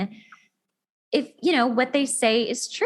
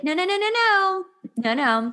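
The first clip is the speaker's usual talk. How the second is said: much higher, insistent no's; mock objecting listener; 9 semitones higher than usual. Host voicing a listener who objects, insistent. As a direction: protest eagerly like a know-it-all listener. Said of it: "who could be like".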